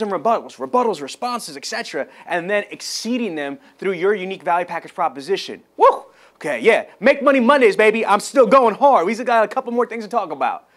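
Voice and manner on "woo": hype whoop. Real, not acted. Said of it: "hype shout".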